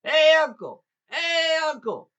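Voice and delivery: long, high, full-voiced holler. A narrator's reading. Jack hollering to his uncle in Virginia, hollering. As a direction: call out to someone miles away. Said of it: "put his hand up to his mouth and hollers".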